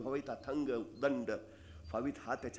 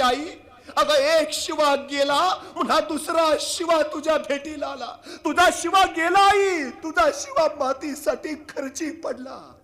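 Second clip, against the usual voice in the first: slow, much higher and louder wailing lament; grieving lament; 14 semitones higher, 11 dB louder, slower than usual. Acted. King breaking the news of Shiva's death, slow loud lament. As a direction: drag out each word in grief. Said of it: "तसे राजा म्हटले".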